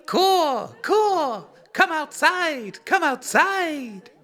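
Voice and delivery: high, slow cawing crow. Acted. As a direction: croak it out like a crow. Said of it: "I'm channeling my inner crow".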